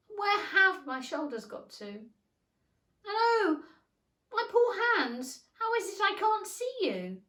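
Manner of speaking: high, loud, rising panic; alarm. A narrator's reading. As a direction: cry out in alarm. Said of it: "changed into alarm".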